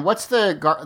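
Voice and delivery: ordinary talk, nothing marked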